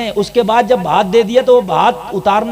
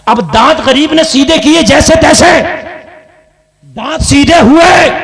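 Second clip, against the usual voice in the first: 1 raised preaching shout; 5 semitones higher, 6 dB louder than usual. Acted.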